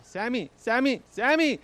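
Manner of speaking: high, loud, slow drawn-out yell of the name; imitated yell. Grandma yelling for her grandson through the door, high and loud. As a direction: yell the name like something's wrong. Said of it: "She would bang on her door and yell".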